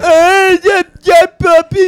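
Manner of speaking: high, loud mocking shout of a name; Bobby Sheehan impression. Co-host mocking a comic friend in a high, loud goofy shout. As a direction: yell it up high like a goofy fan. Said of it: "I just did a Bobby Sheehan impression".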